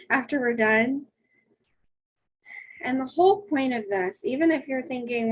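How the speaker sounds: ordinary talk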